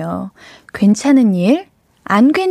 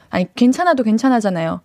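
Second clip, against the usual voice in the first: normal talking voice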